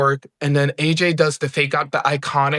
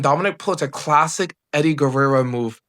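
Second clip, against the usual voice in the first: usual speaking voice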